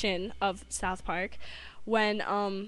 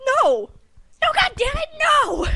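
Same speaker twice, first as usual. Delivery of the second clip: very loud, high cartoon screaming; mock furious denial; 12 dB louder, slower than usual. Boy refuting the doctor again, loud high mock fury. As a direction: yell it in hammed-up exasperation. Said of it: "He's like".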